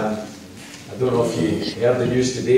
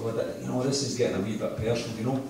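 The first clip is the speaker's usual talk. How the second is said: hushed aside; 10 dB quieter, faster than usual. Retold or imitated. Ironic inner thought before reveal.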